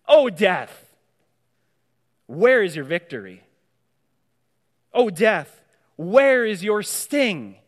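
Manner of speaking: loud, defiant taunt at death; proclaiming defiance. Acted. Preacher taunting death before the congregation in a loud, defiant voice.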